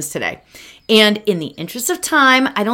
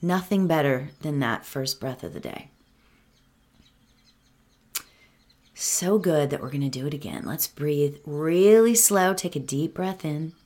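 guided meditation; 6 dB quieter than usual. Acted. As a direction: ease them into calm, one breath at a time.

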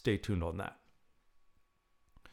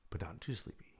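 soft unvoiced whisper; 10 dB quieter than usual. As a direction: whisper it like a secret aside. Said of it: "whispered aside in meditation".

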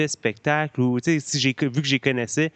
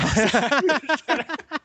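short laugh; 13 semitones higher than usual. Real, not acted. Laughs after called biased.

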